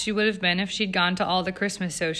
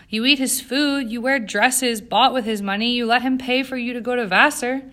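raised, higher-pitched accusation; accusing; 5 semitones higher, 6 dB louder than usual. A narrator's reading. Jane reproaching Eleanor over her father's money, accusing. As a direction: pile up the charges, louder and higher.

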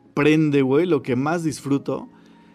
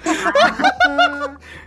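high burst of laughter. Real, not acted. Laughing at the answer.

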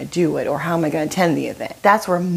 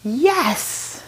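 high, drawn-out exclamation; sudden shared realization; 14 semitones higher than usual. Retold or imitated. Friends all realize it at once, excited agreement. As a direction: burst out in high-pitched agreement. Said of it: "realization on all our faces was all at once".

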